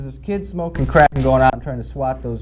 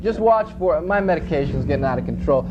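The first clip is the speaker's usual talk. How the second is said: ordinary talk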